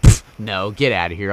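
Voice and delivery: usual speaking voice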